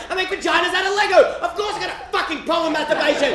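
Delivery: high, shouted desperate confession; tearful outburst. Retold or imitated. Boy on his knees confessing to church lady, high desperate shouting. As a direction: crack and confess it all loudly. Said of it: "tears fall from my eyes and I respond with".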